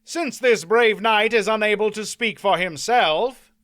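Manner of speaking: loud, formal proclamation to the field; announcing knight. A narrator's reading. Knight announces the baron's defeat to the crowd, formal. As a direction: declare it to the crowd like a herald. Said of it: "Mander Allen announced".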